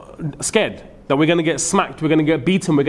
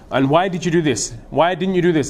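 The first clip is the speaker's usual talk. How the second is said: usual speaking voice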